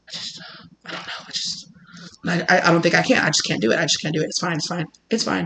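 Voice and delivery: imitated hushed, faltering self-talk; barely voiced, repeating under the breath; anxious muttering. Host looking at their phone, anxious muttering.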